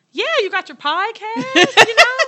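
squeaky high mock man. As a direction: mimic him high and loud.

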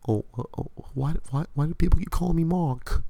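high, flustered British nerd voice; mock panicked Steven with a high voice; confused Stephen Grant. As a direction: squeak out his bewildered protest. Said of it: "just as disoriented as, as, uh, Stephen Grant".

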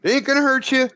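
high, pleading character voice; stranger's voice. Acted. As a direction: plead urgently from out in the dark. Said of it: "The voice".

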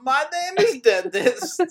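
high, loud, slow hammed-up German accent; mock Christoph Waltz. [name] mocking Waltz's Django line, high German accent. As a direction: ham up a pompous German doctor. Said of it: "Is he like".

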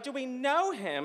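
high-pitched disbelieving question; incredulous echo. Retold or imitated. Friends incredulous at his question.

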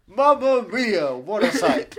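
loud, drawn-out exclamation; mock stage announcer. Host riffs on Meryl Streep's act, loud mock announcer. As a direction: ham up the film title like a showman. Announcer bit, film-title puns.

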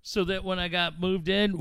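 usual speaking voice

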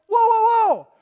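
high, loud startled shout to stop them; alarmed whoa. Retold or imitated. Preacher voicing a shocked believer halting the gushing crowd. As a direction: pull the brakes in alarm. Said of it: "And you're like".

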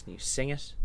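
usual speaking voice

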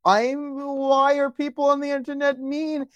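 high whining mock voice; whiny crybaby. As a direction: whine it like a sulky kid. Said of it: "sit there and cry and you're like".

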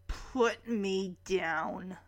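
slow, low, quiet words through gritted teeth. Retold or imitated. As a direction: hold back annoyance, teeth clenched. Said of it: "Max is just gritting his teeth".

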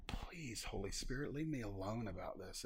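soft, half-whispered pleading; hushed plea. Retold or imitated. Preacher confessing his prayer, soft whispered plea. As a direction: beg quietly under your breath.

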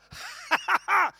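high-pitched laugh put on as a response; demonstrated laugh. Shown as a demonstration. Preacher showing his response to accent imitators, high laugh. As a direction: burst into a high, performed laugh. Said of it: "I've responded with a".

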